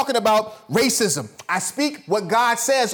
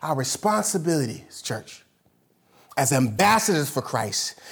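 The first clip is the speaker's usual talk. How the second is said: ordinary talk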